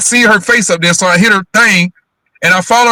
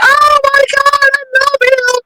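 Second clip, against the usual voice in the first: much higher, slow gushing woman's voice; imitating Flo; slower than usual. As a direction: gush sweetly like an excited friend. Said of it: "she's like".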